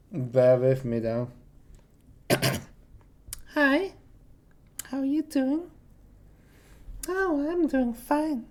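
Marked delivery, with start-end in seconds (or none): 3.5-8.5 high, light put-on woman's voice; female voice. Shown as a demonstration. Man attempting his difficult female accent, high female voice. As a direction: pitch it way up as a woman. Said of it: "It's the female accent".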